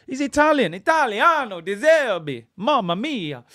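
loud, high, hammed-up Italian accent; mock Italian accent. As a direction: ham up a stage Italian exclamation. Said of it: "last time I do that accent".

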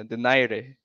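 "Donaire" as doh-NAI-reh; Filipino pronunciation. Shown as a demonstration.

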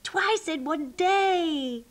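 high, stretched-out cheer; excited shout. A narrator's reading. Sheep thrilled at a second park trip, cheering slowly and high. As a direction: drag out the last word in delight. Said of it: "shouted the sheep".

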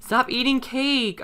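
high, slow exasperated scolding. Real, not acted. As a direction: whine it at the sim in disbelief. Sim keeps eating cake.

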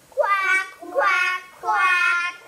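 full-voiced duck quacks; loud quacking. Kid answering the duck riddle with loud quacks.

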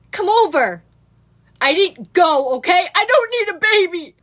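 loud, emphatic protest; loud defensive outburst. Acted. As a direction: blurt it out defensively at full volume. Defensive retelling of ex's text.

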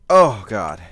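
real pained oh God; pained cry. Real, not acted. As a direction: cry out at the diaphragm spasm. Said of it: "my diaphragm's".

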